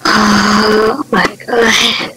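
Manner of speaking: slow, low, drawn-out oh my God; stunned. Real, not acted. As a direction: draw it out in shock. Slow awed exhale after scare.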